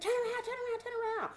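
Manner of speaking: high, repetitive herding shout; insistent high-pitched chant of commands; imitated hollered orders. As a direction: mimic a guard hounding prisoners. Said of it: "screaming and hollering at us".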